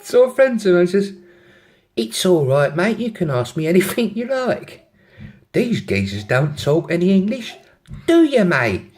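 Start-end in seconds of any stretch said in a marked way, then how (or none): 2.0-8.9 higher, put-on London accent; matey London girl, pitched up. London girl reassuring her friend in the taxi, London accent. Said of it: "have a go at the accents".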